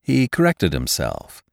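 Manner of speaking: ordinary delivery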